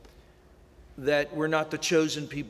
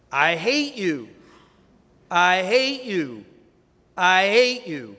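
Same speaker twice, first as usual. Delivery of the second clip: slow and louder, thrown back across the chasm; booming echo; 5 dB louder, slower than usual. Acted. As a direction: echo it back harder each time. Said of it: "a voice comes back".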